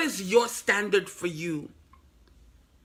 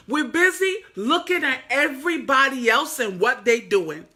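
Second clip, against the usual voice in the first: ordinary talk, nothing marked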